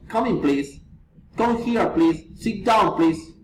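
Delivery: normal talking voice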